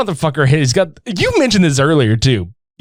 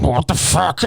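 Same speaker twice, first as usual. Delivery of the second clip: mumbling. Retold or imitated. Imagining Bigfoot confused, mumbling "What the fuck?" under his breath. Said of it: "I pictured him just mumbling".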